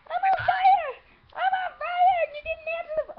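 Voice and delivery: laughing mock screams, very high; screaming. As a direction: shriek while laughing. Pretends to be on fire.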